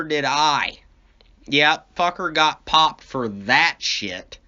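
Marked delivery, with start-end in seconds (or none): none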